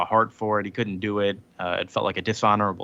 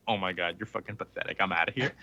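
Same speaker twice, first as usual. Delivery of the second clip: softer, fast, contemptuous brush-off; disgusted dismissal; 4 semitones lower, 8 dB quieter, faster than usual. Retold or imitated. Talos sneering at the groveling Hulk, mild contempt. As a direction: toss it off in quick disdain. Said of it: "Talos goes".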